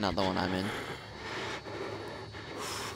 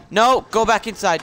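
urgent command; 6 semitones higher, 4 dB louder than usual. Real, not acted. Panicked command at the game.

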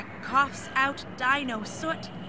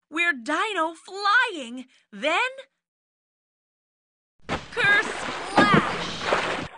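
higher, louder rush ending in a splash; thrilled flight; 8 semitones higher, 4 dB louder, much slower than usual. A narrator's reading. Dinos flying off the bridge into the lake, excited. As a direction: ride the words into the water.

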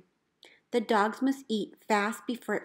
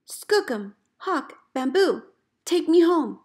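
desperate call; 7 semitones higher, 7 dB louder than usual. Retold or imitated. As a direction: beg the dogs to bring you home. Lost in storm, calling dogs.

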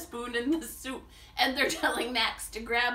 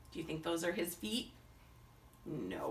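usual speaking voice

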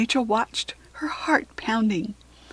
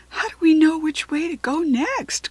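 raised, higher-pitched urgent question; mild shout; 6 semitones higher, 3 dB louder than usual. A narrator's reading. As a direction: call it over the noise, half-shouted. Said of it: "Kirsty shouted above the noise".

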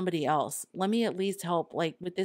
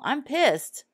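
"I'm pissed" spat out higher and louder, peak of the anger; pissed outburst; 9 semitones higher, 5 dB louder than usual. Retold or imitated. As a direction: let the retold rage burst on "pissed". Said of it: "It was like an anger".

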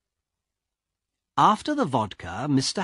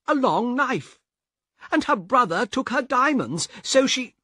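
high, rushed explanation; a frightened man talking fast to the guard; hurried shaken man; 13 semitones higher, 4 dB louder than usual. Acted. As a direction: blurt it out quickly.

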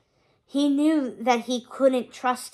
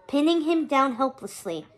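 ordinary delivery

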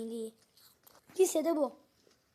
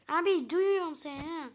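ordinary delivery

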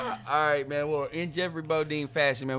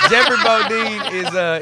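laughing; 9 semitones higher, 8 dB louder than usual. Real, not acted.

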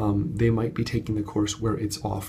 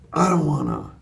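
whiny, sulky refusal, a bit louder; reluctant participant; 5 semitones higher, 6 dB louder than usual. Retold or imitated. Reluctant participant refusing to share, mildly whiny. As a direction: pout it like a kid who won't. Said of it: "was just like".

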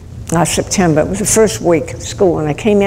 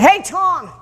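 mock yell; 12 semitones higher than usual. Priest calling after a student at the door, mock yell. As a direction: call after someone, as retold. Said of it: "I yelled after the kid".

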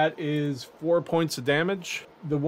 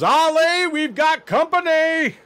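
high, loud yell to an ally; 13 semitones higher, 6 dB louder than usual. Acted. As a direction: holler a warning to your buddy. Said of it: "And he yells".

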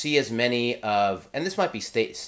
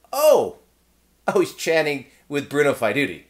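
high, louder starstruck oh; can't believe who else is standing there; double-take surprise; 4 semitones higher, 7 dB louder than usual. Retold or imitated. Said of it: "double take at second designer".

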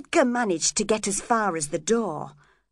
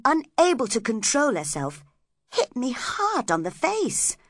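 ordinary delivery, nothing marked

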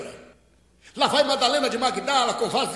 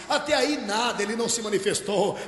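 ordinary talk, nothing marked

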